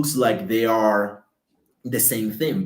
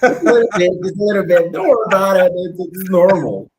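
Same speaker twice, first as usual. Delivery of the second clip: laughing; 9 semitones higher, 8 dB louder, faster than usual. Real, not acted. Laughing reassurance after gringo joke.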